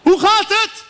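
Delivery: very high, loud phone holler; father shouting. Retold or imitated. Father on the phone to Morocco, full shouting. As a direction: bellow each word down a long-distance line. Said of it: "Die schreeuwde echt".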